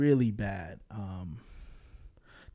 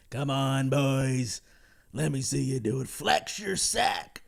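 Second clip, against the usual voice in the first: Mark Hamill. Retold or imitated. Film reviewer mimicking an actor egging on the boys, hammed-up impression. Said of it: "Mark Hamill, he's funny. He's doing the whole".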